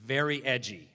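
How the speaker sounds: normal talking voice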